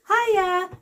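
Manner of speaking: high, loud sing-song "Hiya"; chirpy British hiya. Retold or imitated. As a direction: pitch it up like a cheery Brit. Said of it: "if you hear someone going".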